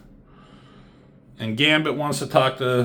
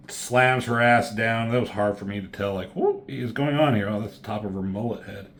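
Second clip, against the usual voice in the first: ordinary delivery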